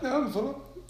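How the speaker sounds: high, suppressed laughter in the voice; trying not to laugh; stifled giggles. Real, not acted. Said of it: "Então para de rir".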